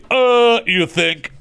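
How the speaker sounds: high, loud mock-surprised "Uh"; sarcastic duh. Real, not acted. Host scoffing at Aaron Judge winning MVP, mock surprise. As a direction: scoff like the answer is obvious.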